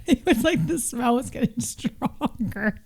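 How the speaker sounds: talking through giggles; real giggling. Real, not acted. Friend teasing about the smell getting stronger, giggling. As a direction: giggle your way through the words.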